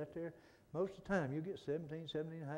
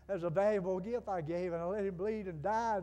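loud, high, building pulpit appeal; impassioned preaching as God; 7 semitones higher, 11 dB louder than usual. Acted. Preacher voices God offering His gift, impassioned preaching. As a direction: plead at full force for them to come. Preaches God's plea at full force.

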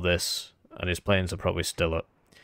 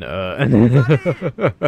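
loud, higher laugh after a stumble; bursting into laughter; 5 semitones higher, 10 dB louder than usual. Real, not acted. Player joking about Soviet casualties, bursting into laughter. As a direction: crack up at your own joke.

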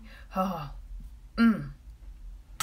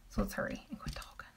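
hushed fast whisper; 8 dB quieter, faster than usual. Real, not acted. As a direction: whisper it quickly like a secret. Teasing aside to viewers.

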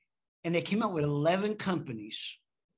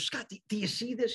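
quick, higher, hushed dramatic coach; dramatic mentor; 6 semitones higher, 4 dB quieter, faster than usual. Retold or imitated. Mentor leaning toward Scott over the survey, dramatic and hushed. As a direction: lean in and make it a big reveal. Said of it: "he's very dramatic when he talks".

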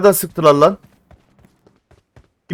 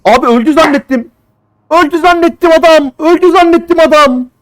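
much higher, exasperated cry; frustrated outburst; 12 semitones higher, 4 dB louder than usual. Real, not acted. Gamer shocked that the enemy survived, high exasperated cry. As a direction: wail it in disbelief at the game.

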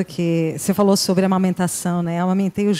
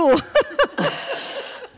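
high laugh. Real, not acted. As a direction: burst of high laughter at the story. Ri ao contar a fala do filho. (risada aguda e solta)

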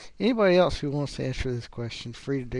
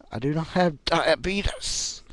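higher-pitched mocking "diabeetus"; Wilford Brimley; 4 semitones higher than usual. Vlogger denying he has it, mock actor voice. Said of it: "if I was, uh, Wilford Brimley".

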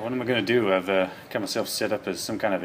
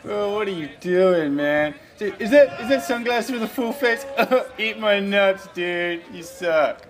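high, loud, goofy bro voice; excited dude voice; 9 semitones higher, 9 dB louder than usual. Acted. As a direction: shout it like a cocky rider.

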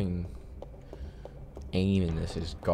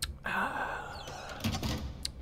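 long exasperated sigh; 13 semitones higher, 11 dB quieter than usual. Real, not acted. Frustrated at the game.

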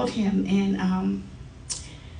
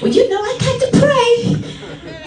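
much higher, bright singsong greeting; mimicking cheery greeting; 13 semitones higher, 4 dB louder, slower than usual. Elder bouncing into a hospital room, much higher cheery singsong. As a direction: pipe it up high like a cheerful visiting elder.

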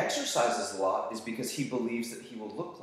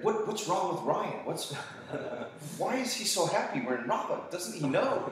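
mock-baffled, higher-pitched questioning; puzzled onlookers; 6 semitones higher than usual. Students wondering about their happy teacher, mock-puzzled. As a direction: imitate bewildered people gossiping about you.

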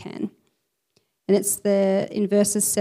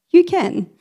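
You can, higher and louder, a rousing shout; emphatic call; 8 semitones higher, 4 dB louder than usual. Shown as a demonstration.

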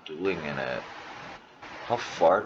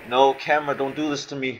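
loud, raised-pitch protest; alarmed protest; 6 semitones higher, 5 dB louder than usual. Real, not acted. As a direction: call out in alarm at the game. Pleading as the camera turns.

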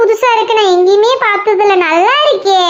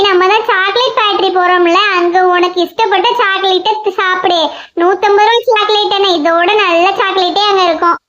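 ordinary talk

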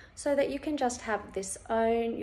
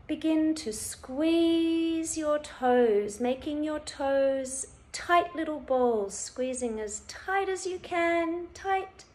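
urging to tense; 6 semitones higher than usual. Shown as a demonstration. Instructor coaching listeners to squeeze their toes, urging to tense. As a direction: rise with the effort of squeezing.

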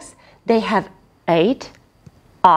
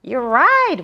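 usual speaking voice

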